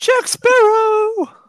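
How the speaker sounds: slow, high, loud shout. Acted. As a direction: belt the name out. Shouted running-joke catchphrase.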